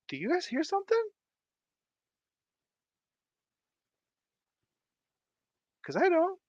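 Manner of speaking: very high sing-song feigned innocence; mock-innocent high squeak; playful falsetto. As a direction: play dumb in a squeaky high voice. Feigns not hearing anything.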